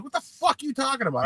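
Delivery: high, fast shocked outrage; mock-incredulous shout of confusion; incredulous outburst. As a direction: blurt it in disbelief. Angry retort in retold call.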